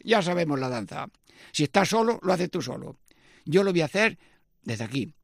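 ordinary talk

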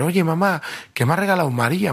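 high, eager report to mom; lightly voiced kid telling his mom; excited boy.

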